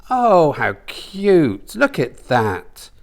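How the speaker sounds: slow, high cooing; older woman's voice. Acted. Mama coos at the tattoo, slow high voice. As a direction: coo over it slowly.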